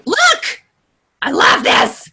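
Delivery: delighted outburst over a discovery; excited delight. Real, not acted. Trainer showing off a Facebook button to the group, excited. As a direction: gush with real excitement. Thrilled by the feature she found.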